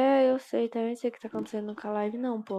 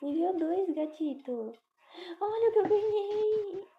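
voz aguda e infantil; voz fofinha; 9 semitones higher than usual. Pretend. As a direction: mostre o gato como criança animada. Mostrando o gato no jogo. (voz fininha de criança)

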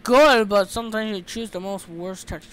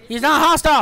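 louder, higher "He's not hostile"; raised, emphatic voice; excited announcement; 6 semitones higher, 8 dB louder than usual. Real, not acted. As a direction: call out the happy discovery. Repeats the discovery louder.

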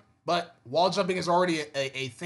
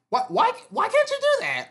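exasperated. Real, not acted. Exasperated rant about game limits.

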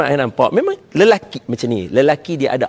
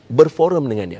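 ordinary delivery

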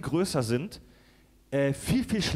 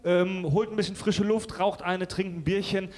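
host sign-off; 5 semitones higher than usual. Acted. Host signing off for break.

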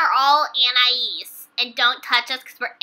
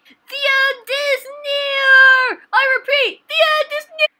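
doomsayer's cry; 10 semitones higher, 5 dB louder than usual. Acted. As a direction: cry out a warning of apocalypse. Doomsayer's announcement.

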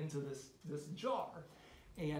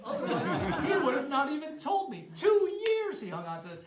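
higher and louder, laughing; laughing disbelief; 8 semitones higher, 10 dB louder than usual. Real, not acted. Telling the audience how long dad waited: laughing disbelief. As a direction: marvel at it through laughter.

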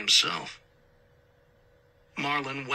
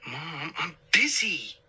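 slow, drawn-out complaint, pitched up; exasperated sigh; 4 semitones higher, slower than usual. A narrator's reading. Son to mom mid-job, slow exasperated sigh. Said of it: "Marlon sighed".

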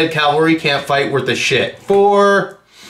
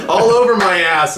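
ordinary delivery

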